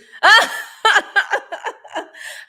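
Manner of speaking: high burst of laughter; real cackling laugh. Real, not acted. Laughs hard.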